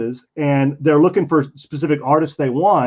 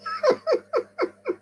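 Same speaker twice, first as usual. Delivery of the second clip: squeaky high burst of laughter; real amused laugh; 6 dB quieter than usual. Real, not acted. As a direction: giggle at the reveal. Laughing at the prank.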